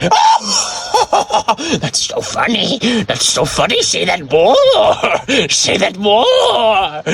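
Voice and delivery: shrieking high, hammed-up hilarity; drawn-out, high-pitched squeals of laughing delight; Jeremy cracking up. Podcaster mimics a listener loving the joke, hysterical glee. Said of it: "He was like".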